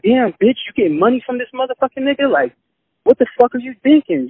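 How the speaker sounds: high, fast disbelief; shocked outburst. Retold or imitated. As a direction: blurt it out in shock. Retold shocked reaction to her.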